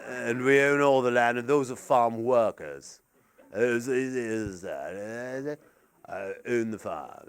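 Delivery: low, soft landowner mumble; English landowner's voice. Acted. Landowner claiming all the land in England, low mumbled English voice. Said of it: "developed a landowner's voice".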